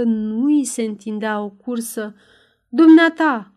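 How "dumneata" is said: much louder, higher outburst; loud astonished challenge; incredulous cry. A narrator's reading. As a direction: cry out in sharp disbelief. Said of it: "strigă Ragastans, care se întreba dacă".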